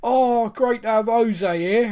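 loud, drawn-out excited fan; Spurs fan reaction. Retold or imitated. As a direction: imitate an enthusiastic fan online. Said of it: "watching various Spurs fan reactions".

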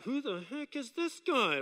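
slow, muttered aside under politeness; private thought. Acted. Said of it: "say one thing but you think another".

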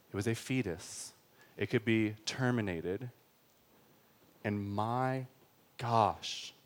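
slow, softened, weighty sermon delivery; grave, measured and hushed preaching; solemn preaching. Real, not acted. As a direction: let each phrase land quietly, with pauses.

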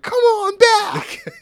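very high, drawn-out loud begging cry; Dolph Ziggler impression. Imitating a wrestler pleading post-match, hammed-up begging. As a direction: wail it like a desperate plea. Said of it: "like he's (begging)".

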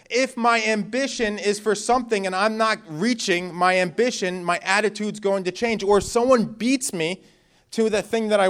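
full-force preaching. Acted. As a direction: build it to the congregation.

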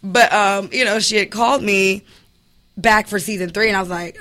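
normal talking voice

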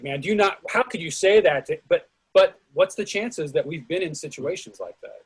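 ordinary talk, nothing marked

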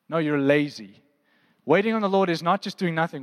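ordinary talk, nothing marked

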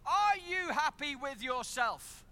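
woman repeating her accusation to his face, slowly; pointed accusation. Retold or imitated. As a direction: jab each word like a pointed finger. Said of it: "She's finger pointed".

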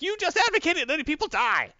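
high, loud, indignant outburst; appalled high-pitched yell, acted out; outraged scolding. Retold earpiece scolding of hero.

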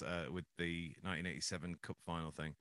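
normal talking voice

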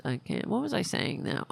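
ordinary talk

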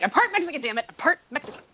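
high exasperated insistence; exasperated insisting. Retold or imitated. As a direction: insist it, fed up. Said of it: "And I'm like".

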